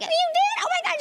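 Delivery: very high, excited squeak; dolphin squeal. Retold or imitated. Aunt answering her niece's news, high dolphin squeal. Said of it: "we sound like dolphins".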